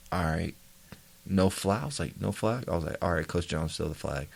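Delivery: very soft, low self-talk; saying it to himself. Retold or imitated. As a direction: keep it under your breath, barely voiced. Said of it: "I'm saying it to myself".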